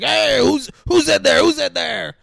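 high, loud mock press shout; clamoring reporters. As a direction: yell like a pushy camera crew, hammed up. Acting camera crews yelling at her.

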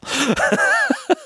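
real high-pitched burst of laughter. Real, not acted. Laughing while retelling the road-rage driver, high giggle. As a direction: laugh up high at how absurd it was.

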